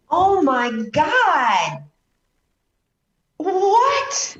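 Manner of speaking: real shock at the news; shocked disbelief. Real, not acted. Host stunned that the guest stood in a minefield, shocked disbelief.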